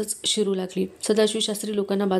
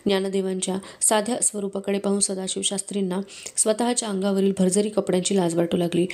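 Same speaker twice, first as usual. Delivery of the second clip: ordinary delivery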